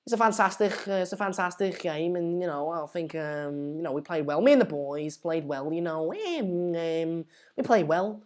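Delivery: hesitant Scouse, fillers stretched right out; Wayne Rooney. Acted. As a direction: do a mumbling Scouse post-match interview. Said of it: "that's Wayne Rooney".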